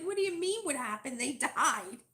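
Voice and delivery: high, loud exasperated retort; imitating the husband's incredulous snap. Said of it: "my husband's like".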